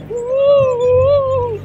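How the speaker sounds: high, sustained "woo"; drawn-out thrilled whoop; long whoop. Real, not acted. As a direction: hold a long high ride scream. Screaming on a park ride.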